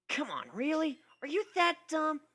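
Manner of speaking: slightly annoyed. Acted. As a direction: let her patience wear thin. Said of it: "getting slightly annoyed".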